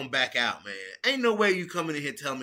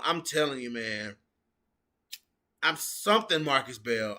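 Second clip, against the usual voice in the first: ordinary talk, nothing marked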